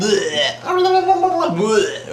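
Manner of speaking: high mock retches mixed with babble; imitated puking. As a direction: act out puking between laughs. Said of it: "like, puking their guts out".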